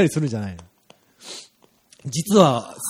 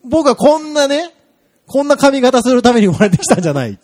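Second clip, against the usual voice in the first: car's inner voice; 7 semitones higher, 4 dB louder than usual. Pretend. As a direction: whine like an offended car. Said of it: "心のつぶやきが聞こえた金色の鈴木パレット".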